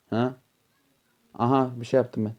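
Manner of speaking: ordinary delivery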